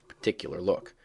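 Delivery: ordinary delivery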